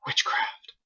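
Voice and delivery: hushed, breathy whisper. Acted. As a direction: breathe it like a fearful rumor. Townsfolk muttering about the explosion.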